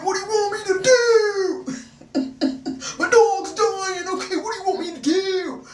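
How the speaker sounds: hammed-up high, loud boyfriend wail; mock wailing. Boyfriend's outburst over dying dog, retold.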